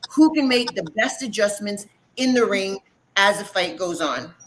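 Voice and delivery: normal talking voice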